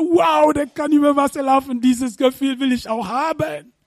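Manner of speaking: high, amazed wow; awed excitement. Acted. Peter marvelling at Jesus walking on water, excited. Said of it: "Er hat gesagt".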